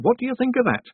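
very high, loud challenge; gossiping village woman. A narrator's reading. Woman finishing the iceberg tale, high and amazed. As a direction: pitch it up for the astonishing news.

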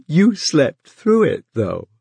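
laughing through the words; slow, amused laugh. A narrator's reading. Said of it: "Pat laughed".

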